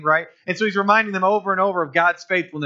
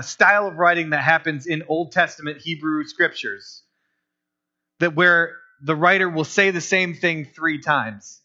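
usual speaking voice

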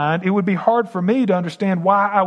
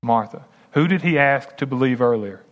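ordinary delivery

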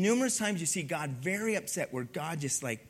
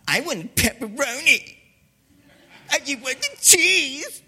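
put-on high, loud kid's whine; whiny ungrateful kid; 6 semitones higher, 8 dB louder than usual. Ungrateful kid to parents after pizza, high whining. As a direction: whine it like a spoiled child. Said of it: "this just terrible attitude".

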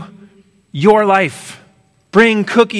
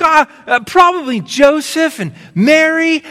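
put-on little boy; 10 semitones higher than usual. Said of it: "he's like".

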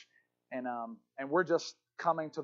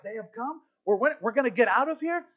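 raised pitch, rising in astonishment; disbelieving question; 5 semitones higher than usual. Retold or imitated. Prisoners hearing they may get out, astonished. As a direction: ask it as if hardly daring to believe.

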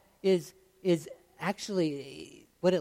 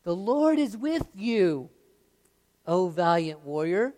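slow, loud, proclaiming; voice of the Lord; 3 semitones higher, 7 dB louder than usual. Acted. The Lord greeting Gideon in a slow, loud proclamation. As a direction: announce it slowly like a divine herald. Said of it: "it's the Lord who said to him".

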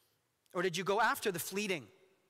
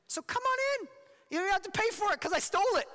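much higher, sing-song come-on; sly temptress invitation; 12 semitones higher than usual. Acted. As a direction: wheedle them into the house. Voicing Folly's invitation.

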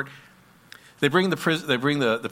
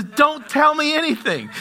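much higher and louder, cutting her off; urgent judge; 13 semitones higher, 7 dB louder than usual. Retold or imitated. Judge cutting off the lady's confession, high and loud. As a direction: stop her before she speaks.

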